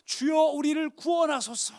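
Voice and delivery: loud, very high drawn-out plea; disciple's impassioned shout; crying out. Acted. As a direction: cry out slowly to be saved. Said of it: "제자들이 막 외치던 외침이에요".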